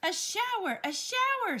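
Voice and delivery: put-on very high, loud children's squeals; screamed. A narrator's reading. Children scream happily jumping into the shower. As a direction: squeal it with glee. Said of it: "screamed Missus Ralph's four children".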